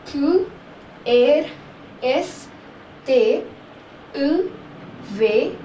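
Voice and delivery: each letter spaced out, the French way; French alphabet. Shown as a demonstration. Teacher reciting the French alphabet for learners. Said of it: "So let's revise once again".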